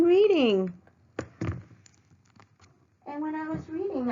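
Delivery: fed up, tell the pet to wait; high-pitched real exasperation at the dog; exasperated talk to the dog. Real, not acted. Scolding the dog mid-reading.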